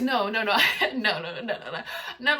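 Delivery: laughing through a string of no's. Real, not acted. Laughing as she tells viewers no, she's kidding. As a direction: giggle while denying it.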